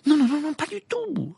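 quick flustered denial, high; hasty denial. A narrator's reading. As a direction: rush out the denial. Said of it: "répondit précipitamment Hermione".

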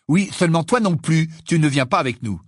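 plus haut et plus fort, fâché; avec colère. Acted. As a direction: Ron rembarre Ginny, sèchement fâché. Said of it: "dit Ron avec colère". (voix en colère)

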